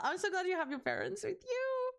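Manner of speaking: ordinary talk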